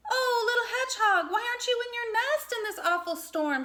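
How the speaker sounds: high, quick, gentle worried voice; a fretting mother doe; mother deer voice. A narrator's reading. Mother Deer worries over the hedgehog, high quick concern. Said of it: "she asked".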